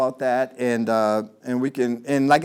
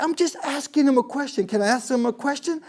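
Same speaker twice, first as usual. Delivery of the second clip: higher, put-upon Freeman voice; Freeman quote; 10 semitones higher than usual. Retold or imitated. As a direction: play Freeman asking innocently. Freeman argues back in quote.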